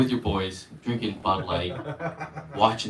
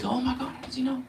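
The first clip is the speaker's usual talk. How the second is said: higher, hushed and fast; amazed inner thought; 9 semitones higher, 10 dB quieter, faster than usual. Retold or imitated. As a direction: gasp it to yourself.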